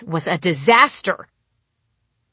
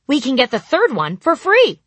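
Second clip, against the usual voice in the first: put-on bright child line; 9 semitones higher, 6 dB louder than usual. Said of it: "she explained to Beth".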